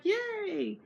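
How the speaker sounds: high, gleeful mock cheer; cheering yay. Friends cheering that Glennis will come, gleeful. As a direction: cheer like a happy kid. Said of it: "So we were like".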